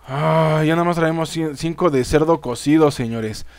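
usual speaking voice

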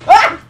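loud yelp of fright; sudden scared shout; startled yelp. Real, not acted. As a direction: jump and cry out. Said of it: "you can hear my voice the exact moment I".

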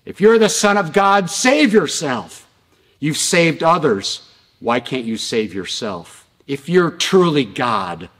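loud, high jeering from a crowd; mocking. People on the ground to Jesus on the cross, mocking. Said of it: "kept mocking Jesus".

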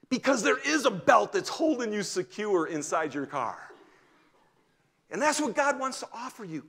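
rising fervent sermon delivery; preaching. Real, not acted. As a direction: preach it hard to the congregation. Preaching at full force.

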